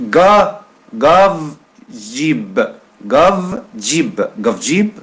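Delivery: slow teaching drill of gav and jib; contrasting g sounds. Shown as a demonstration. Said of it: "un exemple qui donne les deux façons pour prononcer".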